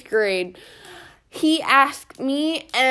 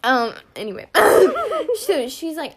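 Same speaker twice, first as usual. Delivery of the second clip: ordinary talk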